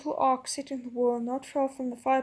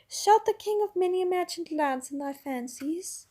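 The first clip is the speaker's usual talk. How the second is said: high, sweet and indulgent; the witch humouring the prince; 6 semitones higher than usual. A narrator's reading. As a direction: humour him like a child. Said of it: "in a soothing voice".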